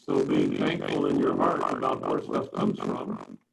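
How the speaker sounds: garbled, underwater-sounding mic; glitching audio. Real, not acted. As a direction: voice muffled like underwater. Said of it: "you're going garbled. Your, your voice is garbled".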